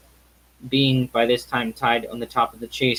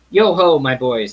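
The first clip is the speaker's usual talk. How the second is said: put-on fat, jolly old master calling out, louder; a rich, hearty hail across the warehouse; jovial call; 6 dB louder than usual. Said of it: "said Fezziwig".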